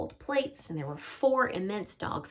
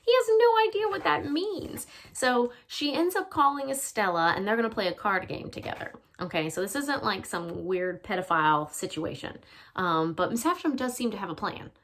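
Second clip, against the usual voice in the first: usual speaking voice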